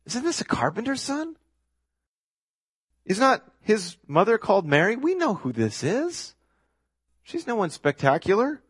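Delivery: high, swooping scoff; mocking crowd, pitch soaring on each question; sarcastic. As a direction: drag it out in high-pitched disbelief. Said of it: "Can you hear the sarcasm here?".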